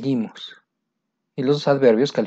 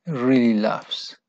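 slow model answer; much slower than usual. Shown as a demonstration. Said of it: "teacher models answer slowly".

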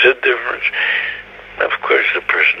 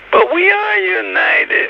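mock starry-eyed insistence, high and drawn out; slow, high-pitched parody of blissful certainty; rosy tone; slower than usual. Head of the yeshiva insisting all are united, in rosy mockery. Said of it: "in this kind of tone of voice".

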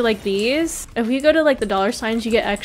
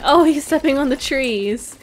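ordinary talk, nothing marked

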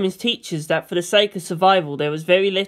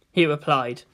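ordinary delivery

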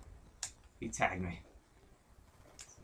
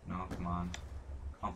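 ordinary talk, nothing marked

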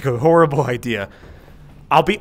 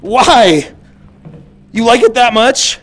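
surprised; 5 semitones higher, 4 dB louder, much slower than usual. Real, not acted. Surprised by the gnome.

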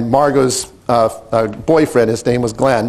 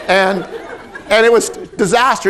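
normal talking voice

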